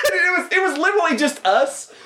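high, giggly burst of laughter over the words; laughing it out, barely holding it together. Real, not acted. Laughing about the bromance.

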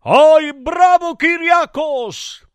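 high, loud acclaim; hammed-up crowd cheer. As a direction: cheer him on in a high, stretched shout. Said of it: "gridavano tutti gli dei".

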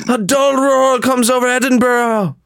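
high-pitched hammy English match commentator; bad English commentary. Streamer calling his team's playoff run, bad English commentary. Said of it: "I broke into a bad English commentary".

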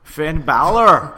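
put-on a brief Irish accent, announced as a second try; Finn Balor impression. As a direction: drawl the name out slowly in an Irish accent. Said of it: "There, there it is a little bit".

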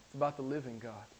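usual speaking voice

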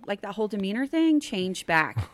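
ordinary talk

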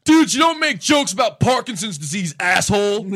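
high, loud mock heckler yell; angry heckler. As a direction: play the furious heckler shouting from the crowd. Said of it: "stands up ... and goes".

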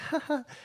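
very high put-on laugh; mocking laugh. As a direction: laugh in a squeaky mocking imitation.

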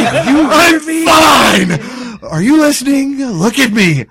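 high, loud insistence, easing slightly; imitated exasperated yelling. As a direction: shout it to convince an attacker. Voices exasperated man under attack.

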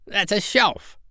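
a little pig protesting; piggy voice. Acted. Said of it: "cried Gub Gub".

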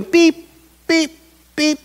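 high, steady machine beeps; high-pitched electronic beep imitation; imitated monitor beep. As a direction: mimic a hospital monitor beeping. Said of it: "the beeper's going".

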